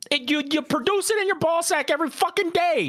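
high, heated rant; indignant rant. Real, not acted. Defending himself to friends, high heated rant. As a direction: rant at the friend who judged you.

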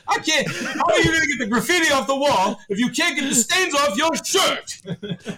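high, exasperated shouted rant; hammed-up scolding, drawing out the final words; mock dressing-down. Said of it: "dress him down quite literally".

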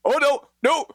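high panicked squeal of no's; panicking teammate. Retold or imitated. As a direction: play the friend falling apart in party chat. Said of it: "being like".

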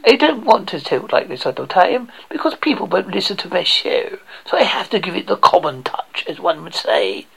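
soft mock upper-class English; posh English accent. Shown as a demonstration. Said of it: "I really talk like this".

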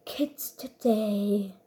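low, slow, soft grumble; Gale imitation. Player copying a brawler's line, low slow imitation. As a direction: imitate a weary game character, quiet and drawn out. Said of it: "von Gale bis Bell".

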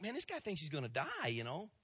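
quick, soft aside to himself; doctor muttering to himself, quick and soft; muttered aside. Retold or imitated. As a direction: mutter it under your breath, fast. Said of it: "He's like".

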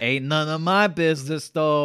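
loud, hammed-up Bronx accent; Tony. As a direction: shrug it off as a Bronx loudmouth. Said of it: "he's totally from, like, the Bronx".

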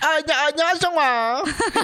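high, hammed-up Australian accent; loose, laughing stab at Australian. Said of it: "some sort of, like, Australian accent".

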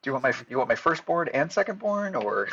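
high and loud, joking; hammed-up eager offer; overeager joking. Mock eager customer.

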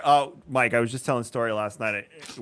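ordinary delivery

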